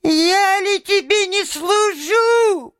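very high, slow, squealing imp; stretched squeaky protest; thin little voice. A narrator's reading. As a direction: pipe it out indignantly, far up in pitch. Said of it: "прибавил он тоненьким голоском".

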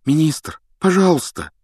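urgent, high-pitched plea; agitated. A narrator's reading. Harry begging the minister to listen, agitated. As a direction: plead in agitation. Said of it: "взволнованно заговорил Гарри".

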